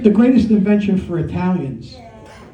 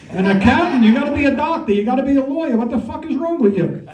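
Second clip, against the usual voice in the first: higher, aghast parental outrage; mock-horrified parent, pitched up; exasperated immigrant parents; 6 semitones higher than usual. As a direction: scold the kids like a horrified mother. Parents voiced reacting to accounting.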